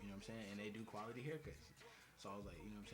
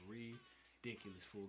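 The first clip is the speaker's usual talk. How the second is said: ordinary talk, nothing marked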